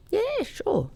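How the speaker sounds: high-pitched, casual yes; retold casual agreement. Singer recalls answering a duet offer, casually agreeing in high pitch.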